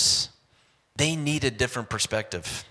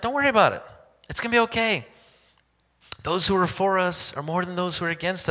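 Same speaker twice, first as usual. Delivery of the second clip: Elisha reassuring; 6 semitones higher, faster than usual. Acted. Elisha calming his servant amid the enemy army. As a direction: reassure the frightened servant, keeping the pulpit energy. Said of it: "And he says to his servant".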